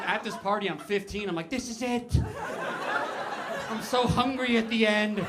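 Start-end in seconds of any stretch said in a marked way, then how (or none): 1.5-5.2 high, strained mock panic; frantic stoned teenager; panicked dying teen. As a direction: act like you think you're dying.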